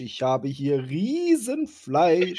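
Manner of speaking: slow, high and loud hammed-up giant; drawn-out teasing giant imitation; mock giant voice.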